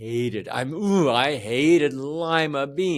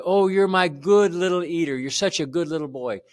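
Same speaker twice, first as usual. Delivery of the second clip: higher and louder, delighted praise; happy praising mom; 7 semitones higher, 5 dB louder than usual. Retold or imitated. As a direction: beam at your little boy. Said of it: "The whole thing about her was happy".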